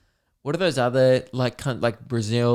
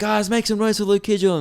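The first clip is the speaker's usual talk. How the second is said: hype MC intro; 9 semitones higher than usual. Retold or imitated. Imitating the MC hyping the crowd.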